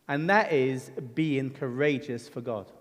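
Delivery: ordinary talk, nothing marked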